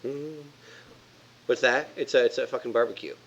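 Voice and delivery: usual speaking voice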